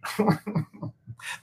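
high-pitched burst of laughter. Real, not acted. Streamer laughs at how Republicans hated him, high and bright. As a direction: giggle at the irony.

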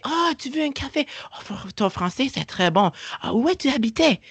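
patronising French server, hammed up; mock French cashier. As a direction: caricature of a France French cashier. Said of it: "Je veux un café".

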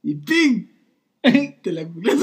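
usual speaking voice

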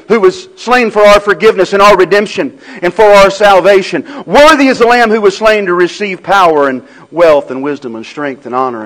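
Worthy belted, high and loud; preaching at full force. Acted. Pastor proclaiming Worthy to the church, full-force preaching. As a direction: shout out Worthy like a hymn of praise. Refrain continues.

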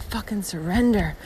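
a hushed whisper of giving in; whispered surrender. Retold or imitated. Telling herself to surrender to the hail, whispered. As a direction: breathe it out as a whisper, letting go.

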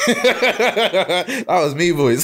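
laughing it out. Real, not acted. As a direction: laugh through the words. Laughing admission.

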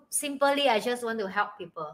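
usual speaking voice

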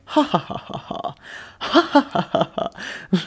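obviously forced "ha ha ha"; fake laughing. Brother-in-law making himself laugh in the rearview mirror, fake laughing. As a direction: imitate a deliberate, put-on laugh. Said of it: "obviously fake laughing".

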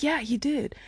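high-pitched, giddy insistence; excited confirmation. Retold or imitated. Confirming to my friend that he replied, excited. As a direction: act out giddily confirming the news.

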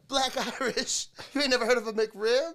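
host quoting Bernie Mac's Charlie's Angels line. Retold or imitated. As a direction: quote the movie line in his voice. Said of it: "Can you please say his line".

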